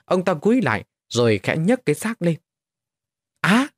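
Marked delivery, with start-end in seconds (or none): 3.4-3.7 short startled yelp; a small gasp of shock; soft startled cry. A narrator's reading. Khương startled by nails in the corpse, soft cry. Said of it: "Ông Khương khẽ kêu lên".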